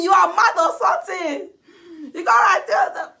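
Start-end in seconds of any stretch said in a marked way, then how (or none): 0.0-1.5 high, loud taunt through laughter; laughing yell of the catchphrase; laughing mock shout. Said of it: "Not one say".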